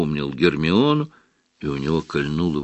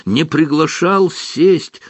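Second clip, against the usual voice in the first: house elf voice; 8 semitones higher, 4 dB louder than usual. Acted. Dobby speaks, overwhelmed.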